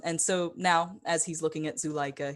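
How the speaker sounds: ordinary talk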